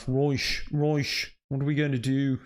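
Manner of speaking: lightly hammed-up posh Dublin accent; posh South Dublin accent. Shown as a demonstration. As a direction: imitate a spoilt South Side Dublin rich kid. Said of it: "sorry, Dublin accent".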